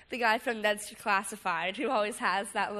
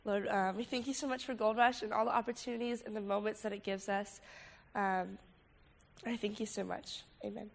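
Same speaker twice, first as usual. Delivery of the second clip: prayer; 5 dB quieter than usual. Acted. Leads a prayer.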